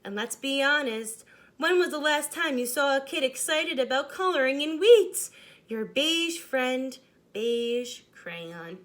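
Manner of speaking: lightly voiced crayon, a touch of complaint; grumbling beige crayon. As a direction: read the letter as a put-upon beige crayon.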